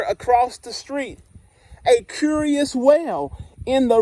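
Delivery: lively picture-book narration, dwelling on the punchlines; reading to children. Acted. Teacher reading Stuck to the class, storytime voice. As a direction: read it like storytime on the carpet.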